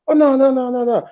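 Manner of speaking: high, frantic mock girlfriend denial; panicked denial. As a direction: squeal her nervous no's. Girlfriend denying meeting anyone.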